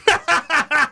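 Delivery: high burst of uncontrollable laughter; helpless laugh. Real, not acted. Host cracking up over the Pirates' title hopes, helpless laugh. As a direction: crack up at your own suggestion. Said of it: "without laughing".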